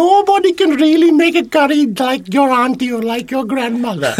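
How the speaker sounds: high, loud gossiping auntie; Sri Lankan auntie accent. Retold or imitated. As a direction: Sri Lankan auntie boasting behind backs. Imitating aunties' behind-the-back comments.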